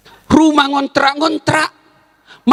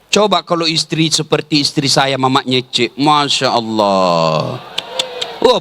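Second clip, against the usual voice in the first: ordinary delivery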